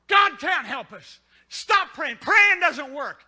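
slow, loud scornful headline; mocking loud quote. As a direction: boom it out slowly, mocking. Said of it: "they will say in the front".

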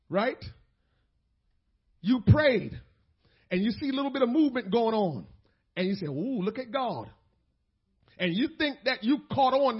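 loud, driving pulpit preaching; fervent sermon delivery. Acted. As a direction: press the point home to the congregation. Preaching to congregation.

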